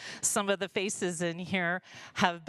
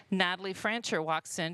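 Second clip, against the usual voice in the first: ordinary delivery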